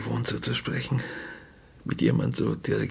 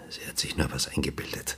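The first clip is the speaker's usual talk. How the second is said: whispered, low and much softer; bitter whisper; 6 semitones lower, 6 dB quieter than usual. Acted. Grieving father mutters about his dead wife, whispered. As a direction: let it slip out under the breath, bitter.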